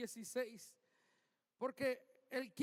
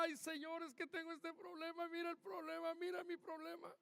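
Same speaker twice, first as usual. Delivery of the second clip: mock whiny complaint; 4 semitones higher, 5 dB quieter than usual. Believer complaining to God.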